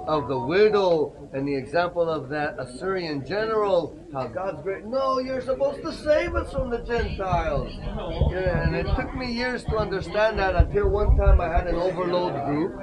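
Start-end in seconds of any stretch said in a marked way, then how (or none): none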